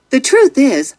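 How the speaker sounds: ordinary delivery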